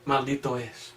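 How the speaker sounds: hushed, grave "Maldito es"; soft echo. Shown as a demonstration. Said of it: "qué dice Pablo".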